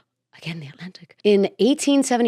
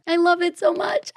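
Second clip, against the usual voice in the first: high gushing; 10 semitones higher than usual. Real, not acted. Gushing about the salon.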